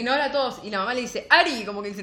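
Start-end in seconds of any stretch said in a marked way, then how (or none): 1.3-1.7 high, loud call of his name; a mother calling her son, sharp and loud; calling out sharply. Retold or imitated. Madre llama al hijo. (llamado fuerte y agudo)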